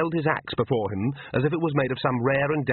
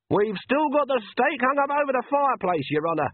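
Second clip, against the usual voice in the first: a higher villager voice, beaming with pride; proud of the family stake, pitched up; 13 semitones higher than usual. A narrator's reading.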